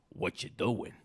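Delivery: low put-on goblin voice. Goblin with coffee mug horns to Herschel, low goblin voice. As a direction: ask it low as a nosy goblin. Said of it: "this goblin with the disgusting toenails".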